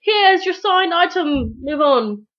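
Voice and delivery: hammed-up star hurrying fans on; mock dismissive celebrity. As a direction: wave the fan along politely. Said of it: "it's like".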